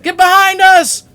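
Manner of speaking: high, loud urgent yell; shouted warning. Acted. William and Urza calling down the hall, shouted warning. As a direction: call it out hard, as if down a long hall. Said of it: "You suddenly hear William and Urza's voices".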